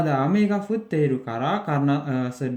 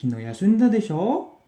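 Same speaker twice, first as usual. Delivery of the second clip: higher, louder question rising into "desho"; intonasi naik; 7 semitones higher, 5 dB louder than usual. Shown as a demonstration. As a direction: check a guess, ending "desho" on a lift. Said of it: "intonasinya naik". (nada "desho" naik, seperti memastikan)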